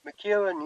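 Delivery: "McEwen" said as muh-KYOO-en; name pronunciation. Shown as a demonstration. Guest confirming the surname for the host, "McEwen" as muh-KYOO-en. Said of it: "I don't know if I pronounced that".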